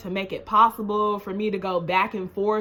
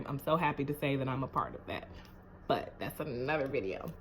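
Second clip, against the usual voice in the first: much softer, fast murmured repeat; quick aside; 7 semitones lower, 12 dB quieter, faster than usual. Shown as a demonstration. Repeating her pride to viewers, softly and fast. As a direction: toss it off under your breath.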